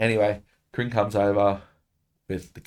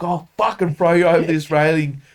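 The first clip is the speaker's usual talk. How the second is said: louder and higher, a hammed-up furious threat; 8 semitones higher, 6 dB louder than usual. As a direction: snarl it like you're about to throw him over. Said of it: "I'm like".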